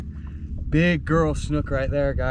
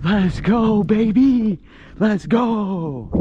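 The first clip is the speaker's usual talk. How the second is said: higher, louder celebratory cheer; excited cheering; 7 semitones higher, 4 dB louder, much slower than usual. Real, not acted. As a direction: cheer the fish on as it swims. Celebrating releasing the snook.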